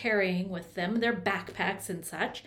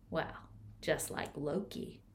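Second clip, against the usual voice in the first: soft unvoiced aside; whispered aside; 5 semitones lower, 9 dB quieter than usual. A narrator's reading. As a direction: breathe it as a secret.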